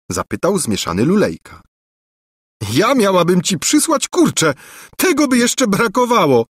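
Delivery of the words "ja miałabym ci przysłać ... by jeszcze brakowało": laughing. A narrator's reading. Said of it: "zaśmiała się księżniczka".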